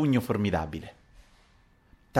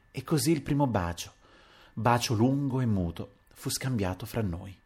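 plain reading voice

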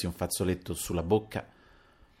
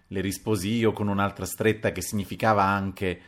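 usual speaking voice

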